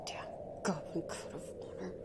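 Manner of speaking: hushed, quick grumble; resentful near-whisper; muttered aside. Acted. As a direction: mutter it under your breath. Elf queen mutters to herself.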